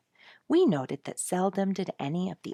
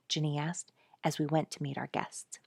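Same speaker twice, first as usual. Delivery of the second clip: plain reading voice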